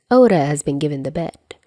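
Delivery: plain reading voice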